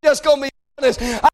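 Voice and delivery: impassioned cry out to God; pleading preaching. Acted. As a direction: preach it as an urgent personal plea.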